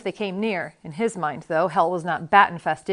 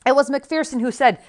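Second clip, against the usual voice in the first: usual speaking voice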